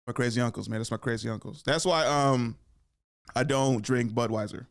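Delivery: ordinary talk, nothing marked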